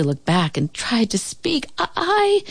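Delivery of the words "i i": stammer. A narrator's reading. Boy stammers in shock.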